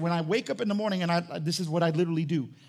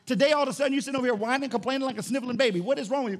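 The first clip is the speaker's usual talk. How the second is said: raised, scolding voice; scolding God; 6 semitones higher, faster than usual. Pretend. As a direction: tell him off like a fed-up father.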